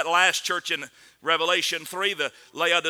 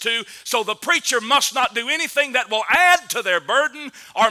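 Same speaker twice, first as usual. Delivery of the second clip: raised, louder preaching build; 6 semitones higher, 6 dB louder than usual. Acted. Preacher warning the church about a victim mentality, full-force preaching. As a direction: preach it hard to the congregation.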